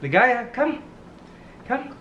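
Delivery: high call across the room. Real, not acted. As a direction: call the bird to come. Calling parrot from afar.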